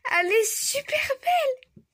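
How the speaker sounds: high, crying voice of joy; tearful joy. Real, not acted. Admiring the shiny card, crying with joy. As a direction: gush through happy tears.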